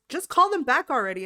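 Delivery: just do it already; exasperated insistence. Retold or imitated. Mom insisting her daughter call the friends back, exasperated.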